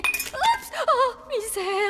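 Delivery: very high, loud yelp then slow groan; startled dismay. Acted. Nearly breaking the ice swan, high startled yelp. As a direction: gasp as it slips.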